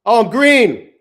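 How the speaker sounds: a burst of delighted surprise at the profit; excited. Real, not acted. Trader sees his trade turn profitable.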